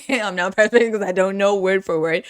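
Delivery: ordinary delivery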